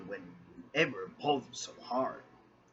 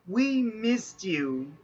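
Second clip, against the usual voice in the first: loud, slow, higher roar; 9 semitones higher, 5 dB louder, much slower than usual. Acted. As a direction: roar it out with joy. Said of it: "roared Ariel".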